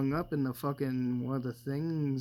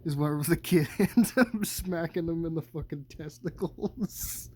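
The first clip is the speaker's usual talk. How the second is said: laughing through the words, rising; cracking up; 3 semitones higher than usual. Real, not acted. Recounting a favorite slapstick part, cracking up. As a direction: tell it while breaking into laughter.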